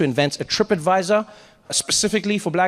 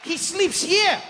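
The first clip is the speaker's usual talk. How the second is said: bubbly tour guide call; overeager tour guide; 12 semitones higher than usual. Retold or imitated. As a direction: greet the group like a way-too-happy guide. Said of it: "he's like".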